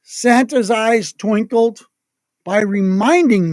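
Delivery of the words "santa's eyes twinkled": ordinary delivery, nothing marked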